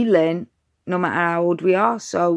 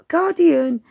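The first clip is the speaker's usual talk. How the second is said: higher, louder call of Guardian; calling the dog; 9 semitones higher, 4 dB louder than usual. Retold or imitated. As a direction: call the dog to the treat cupboard. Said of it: "So I go".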